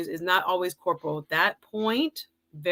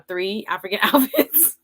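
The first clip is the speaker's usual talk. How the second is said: slow, laughing confession; laughing shame; 5 semitones higher, slower than usual. Real, not acted. Host admitting her outfits fall short at Nigerian weddings, laughing slowly. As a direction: giggle through the admission.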